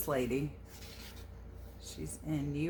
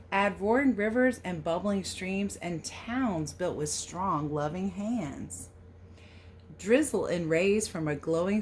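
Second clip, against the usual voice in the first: storybook read-aloud; 3 semitones higher, 5 dB louder than usual. A narrator's reading. Reading the American Pie book aloud to children, storybook lilt. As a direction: read the verse a little louder, with a gentle lilt. Reads the picture book text.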